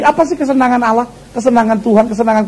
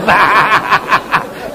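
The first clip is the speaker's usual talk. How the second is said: laughing; 4 semitones lower, 3 dB quieter than usual. Real, not acted. Laughing at own joke.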